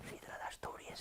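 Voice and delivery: very soft, whispered aside; barely voiced, intimate mock-confidential hush. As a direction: drop to a secretive whisper. Seductive night-out answer, whispered.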